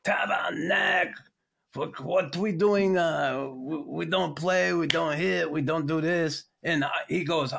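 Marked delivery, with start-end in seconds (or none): 0.0-6.4 put-on Quebec coach's rant in a French accent, slow and raised; French-Canadian coach. Coach yelling at his team after the period, French-Canadian accent. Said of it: "Bergie comes in after the period and he goes".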